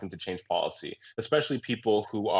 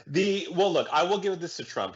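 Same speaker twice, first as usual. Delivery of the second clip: usual speaking voice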